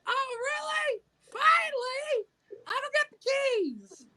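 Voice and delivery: high excited yell; delighted shout. Retold or imitated. Mom shouting back, thrilled about her son.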